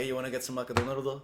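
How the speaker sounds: put-on McDonaldo in a playful Japanese accent; teasing Japanese accent. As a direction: tease a friend by copying his Japanese accent. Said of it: "it's like".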